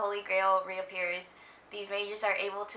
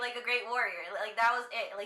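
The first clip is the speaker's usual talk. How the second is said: ordinary delivery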